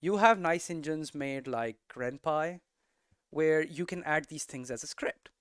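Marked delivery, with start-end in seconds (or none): none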